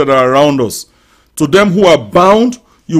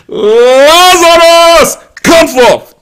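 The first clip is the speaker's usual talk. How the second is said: higher, louder, slow drawn-out shout; Jesus calling Lazarus with a great cry; 11 semitones higher, 6 dB louder, much slower than usual. Pretend. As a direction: call the dead man out of the grave at full voice. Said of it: "cried with a loud voice".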